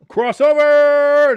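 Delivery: high, loud, long-held shout; excited match call. Real, not acted. Commentator calls the cross coming in, excited drawn-out yell. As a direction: stretch the word as the ball sails over.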